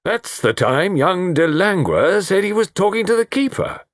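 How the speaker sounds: high, excited, blustering exclamation; Colonel's voice pitched right up; astonished Colonel. Acted. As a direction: burst out with the sudden realisation.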